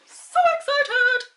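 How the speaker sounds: high, drawn-out excited squeal; sing-song excitement. Real, not acted. Vlogger on her lip colour, sing-song excitement. Repeats the phrase, far bigger.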